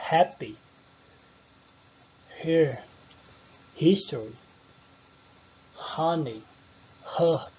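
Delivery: "Happy", "Here", "History", "Honey", "Her" each with a clear breathy h at the start; aspirated h. Shown as a demonstration. Teacher shows students the sounded h, breathy onset. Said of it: "Percebem que eu tô fazendo aquele som".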